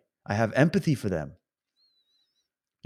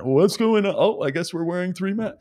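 higher-pitched, mildly mocking naive voice; lightly voiced bewildered bystander; clueless average person; 6 semitones higher than usual. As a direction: play an oblivious person just looking up. Voicing average person reacting.

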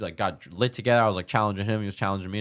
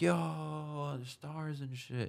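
starstruck awe; 7 semitones higher, 10 dB quieter, much slower than usual. Retold or imitated. Retelling his awe at the stars.

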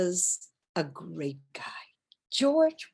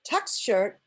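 usual speaking voice

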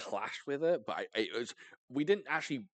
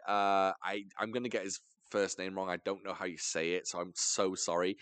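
ordinary delivery

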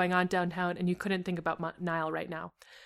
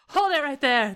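high, raised command; yelled; 7 semitones higher, 4 dB louder than usual. A narrator's reading. Robber holding Niall to the intruder, yelling. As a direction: yell it as an armed robber. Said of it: "the man holding Niall yelled".

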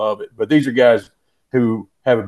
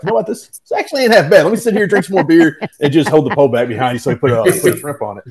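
higher, louder, playing the happy lazy client; hammed-up, easygoing, lounging with a beer; lazy contented fisherman; 5 semitones higher, 3 dB louder than usual. Fishing guest, to the guide, mock-relaxed and much higher. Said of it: "and you're like".

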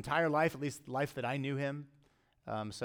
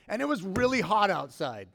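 higher, louder hammed-up grievance; mock complaining; 6 semitones higher, 6 dB louder than usual. Preacher playing the grumbling vineyard workers, mock complaint. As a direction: protest like a put-upon worker, played for laughs.

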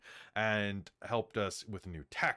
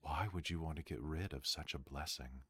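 game master narration; 9 semitones lower, 7 dB quieter than usual. A narrator's reading. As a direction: narrate the scene in a hushed low voice. GM narrating scene after play.

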